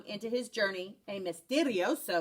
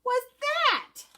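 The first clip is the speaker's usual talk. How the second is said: high, slow, amazed; startled question; 13 semitones higher, 4 dB louder, slower than usual. A narrator's reading. Kitten puzzled by his reflection, high slow wonder. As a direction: stretch it out in wonder.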